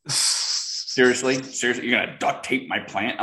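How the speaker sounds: slow, drawn-out disbelief; mock outrage, stretched Seriously; incredulous friend. Friend doubting the duct tape fix, slow incredulous disbelief. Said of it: "she's looking at me like".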